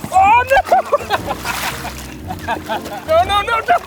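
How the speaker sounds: high startled oh no; alarmed. Real, not acted. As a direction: cry out as the dog slips. Dog falls off.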